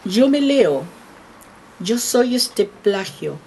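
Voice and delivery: slow, solemn poetry reading; first try at reading the poem, slow and measured. Acted. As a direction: read it out to the room line by line. Poet starts reading her poem.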